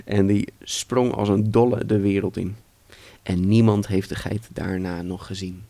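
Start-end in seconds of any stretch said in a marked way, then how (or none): none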